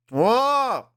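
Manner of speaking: high surprised whoa; amazed whoa. Real, not acted. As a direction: react with big amazement. Loud amazed reaction.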